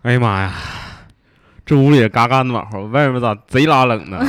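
chatty Dongbei exclamation; northeastern Chinese accent. Pretend. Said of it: "用哈尔滨话来描述一下".

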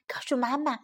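plain reading voice

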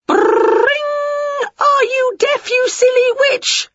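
high, loud, rude ringing door; yelling door. Acted. The door yell shouts at the slow witch, high and loud. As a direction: bellow the insult like a doorbell. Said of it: "yelled the door yell".

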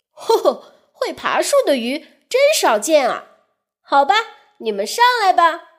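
loud, fast, hearty fish voice; a jolly big fish welcoming guests; big flying fish. Acted. (洪亮、快速、爽朗的大飞鱼)

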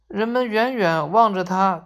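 ordinary delivery

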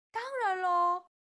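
high, loud, certain reply; resounding childlike yes; emphatic assurance. A narrator's reading. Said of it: "哈瓦尔肯定地说".

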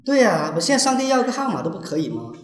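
demanding caller. Acted. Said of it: "来模拟一下嘛".

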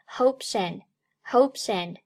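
"hopes and" joined, s carried into "and"; linked pronunciation. Shown as a demonstration. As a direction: slowly link "hopes and" as one word. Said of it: "Hopes和and连读".

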